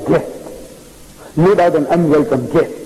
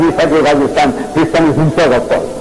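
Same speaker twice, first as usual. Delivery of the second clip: ordinary talk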